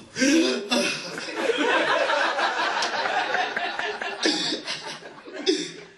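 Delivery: a long high laughing fit. Real, not acted. Comic helpless laughter at Carlos at the funeral. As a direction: laugh hard, on and on.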